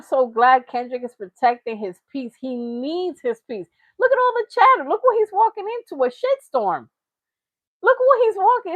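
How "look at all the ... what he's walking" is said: excited. Real, not acted. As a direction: shout it out, amazed. Hyped about the chatter round [name].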